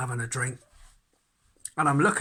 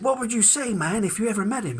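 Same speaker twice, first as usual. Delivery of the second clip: eager interviewer; 9 semitones higher than usual. Retold or imitated. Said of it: "He said".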